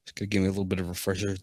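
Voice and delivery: ordinary talk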